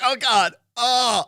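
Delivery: high, loud panicked whining; terrified gibbering. Retold or imitated. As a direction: babble in pained panic. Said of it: "I literally did this".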